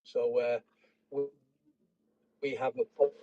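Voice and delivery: echoing, garbled stream playback; words replayed faintly through a laggy call; bad connection. Real, not acted. Stream dropping, echoed replay.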